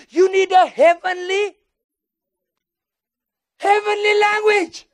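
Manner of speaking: loud, high, slow preaching; preacher driving home the point, loud and slow; preaching build. Acted. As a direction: stretch it out like a preacher at full force. Preaching climax.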